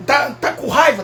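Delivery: usual speaking voice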